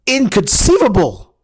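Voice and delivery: high, loud, outraged cry of disbelief; Vizzini impression. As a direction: shriek it like a baffled villain. Movie quote impressions opening vlog.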